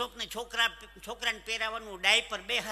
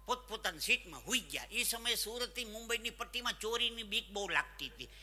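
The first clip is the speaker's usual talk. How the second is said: ordinary talk, nothing marked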